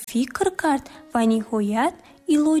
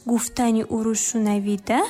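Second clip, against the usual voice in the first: usual speaking voice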